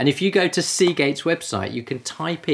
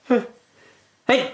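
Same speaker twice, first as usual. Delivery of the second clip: sneezing; 12 semitones higher than usual. Real, not acted. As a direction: sneeze hard, hey-shaped burst. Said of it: "Oh, gonna sneeze again".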